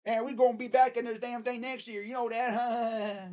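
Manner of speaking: loud, high mock Alabama accent. Host plays Bama fan promising to be back next year, hammed-up Alabama accent. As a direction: holler it like a bragging fan with a fake Alabama twang. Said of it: "I can't do my freaking Alabama accent".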